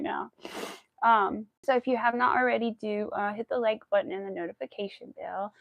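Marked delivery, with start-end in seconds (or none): none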